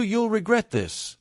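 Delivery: mildly dramatic quote, drawn out high; mock dramatic threat. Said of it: "every word was delivered with such drama".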